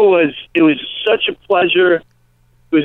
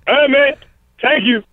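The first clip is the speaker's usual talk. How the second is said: lightly voiced Eddie Murphy, pitched up, laid-back greeting; a light take on Eddie Murphy's casual hello; Eddie Murphy impression; 8 semitones higher than usual. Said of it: "Eddie Murphy".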